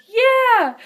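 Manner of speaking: imitating an eager child's high, loud cheer; excited kid's yell. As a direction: shout it like a thrilled little kid. Acting her kid's eager answer.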